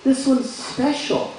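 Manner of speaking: put-on high, drawn-out kid voice; young son. Son pleading for another ball, high slow kid voice. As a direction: say it slowly in a high childlike pitch. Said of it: "And he's like".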